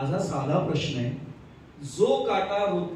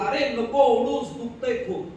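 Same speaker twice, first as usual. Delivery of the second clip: higher, louder, slow plea in pain; pained cry; 5 semitones higher, 4 dB louder, slower than usual. Retold or imitated. Friend begs the other to stop pulling the thorn, louder pained cry. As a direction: cry out to stop, hurting, slowly. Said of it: "हा ओरडला".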